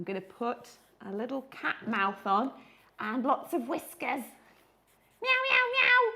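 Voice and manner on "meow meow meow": high playful miaowing; cat meows. Acted. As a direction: miaow like a little cartoon cat. Drawing a cat face, miaows.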